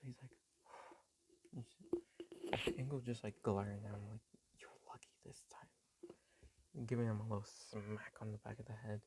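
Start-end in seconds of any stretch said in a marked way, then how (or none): 4.6-5.7 a hushed, threatening whisper through a glare; menacing whisper. Retold or imitated. Mother glaring at her son after he covers for her: menacing whisper. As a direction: breathe the warning low, like a mother promising payback later.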